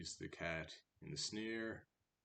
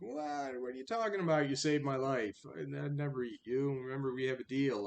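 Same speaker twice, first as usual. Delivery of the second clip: higher and louder, fast injured protest; mock-indignant cat; 7 semitones higher, 8 dB louder, faster than usual. Cat insisting to the rat it would never eat him, loud injured protest. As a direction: act wounded and innocent, overplaying it.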